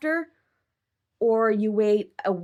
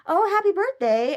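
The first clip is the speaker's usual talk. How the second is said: imitating her mom, high and a touch louder; mom's sleepy birthday greeting; 10 semitones higher, 3 dB louder than usual. Mother woken by call, mock bright high birthday greeting. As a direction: chirp a groggy happy birthday up high.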